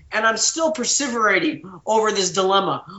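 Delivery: ordinary talk, nothing marked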